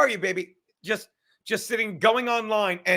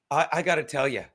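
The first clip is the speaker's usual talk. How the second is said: usual speaking voice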